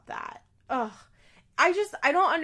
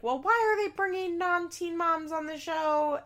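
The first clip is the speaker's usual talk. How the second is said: high, whiny outraged question; pitched-up mock outrage at the show; mock indignant anger; 8 semitones higher, 3 dB louder than usual. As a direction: imitate your own past huffy complaint. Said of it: "anger that I had".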